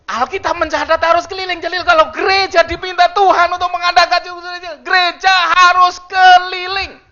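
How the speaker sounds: loud, high preaching voice; preaching at full force. Acted. Pastor booming to the congregation, loud and high. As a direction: preach it out to the church.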